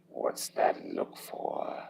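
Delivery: low, slow, whispered tease; teasing. Acted. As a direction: tease him in a deep hush. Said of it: "he teased".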